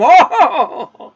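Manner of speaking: loud, high burst of laughter. Real, not acted. Laughing loudly at her own warning to the listener. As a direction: let a delighted laugh ring out.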